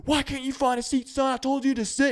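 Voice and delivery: high, loud drill sergeant yell. Retold or imitated. Sergeant yelling at the kid with no seat, loud. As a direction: tear into him like a sergeant. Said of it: "Sergeant goes right up to him and goes".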